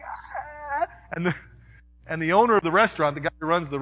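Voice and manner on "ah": high, soft pained whimper; whimper, almost crying. Retold or imitated. Son in pain at the restaurant, a high soft whimper. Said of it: "He's like".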